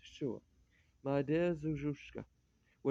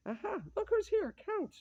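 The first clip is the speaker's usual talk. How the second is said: high, gleeful Aha that shoots way up; hammed-up joyful greeting; delighted exclaim. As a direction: crow with glee at the surprise guest. Greets the arriving Count loudly.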